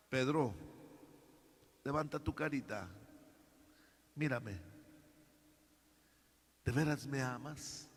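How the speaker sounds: Jesus gently asking Peter, soft and low; tender Jesus. Acted. Jesus tenderly lifting Peter's face.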